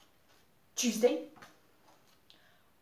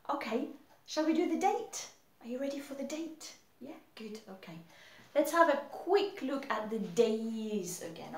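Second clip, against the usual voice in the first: ordinary talk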